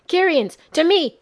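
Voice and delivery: loud, high battle cry; rallying shout to his fellow Kirians; shouting a command. A narrator's reading. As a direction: summon them at the top of your voice. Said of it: "shouted Master Aiku".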